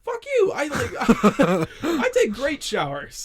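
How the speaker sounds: loud, high, laughing protest; laughing mock outrage. Real, not acted. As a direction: shout it while cracking up.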